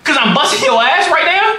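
high-pitched mock protest; acted indignant retort. Son answering dad's threat over basketball, indignant protest.

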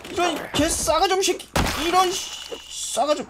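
high, strained swearing; high-pitched indignant cursing; rattled cursing. Real, not acted. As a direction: curse the attacker right after a scream. Cursing attacker after scream.

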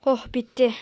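breathed relief under the breath; relieved whisper. Acted. Worn-out employee whispering relief after a task.

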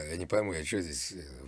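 normal talking voice

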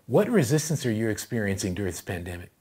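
usual speaking voice

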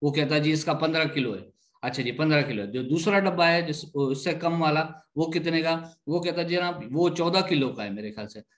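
ordinary talk, nothing marked

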